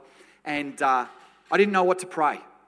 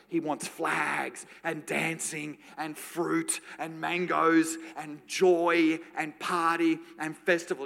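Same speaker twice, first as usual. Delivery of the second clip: usual speaking voice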